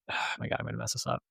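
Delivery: sighing, very soft aside to self; hushed self-doubting mumble; muttered aside. Real, not acted. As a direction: mutter it under a sigh, almost voiceless. Losing track of a version number.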